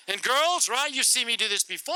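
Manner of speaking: usual speaking voice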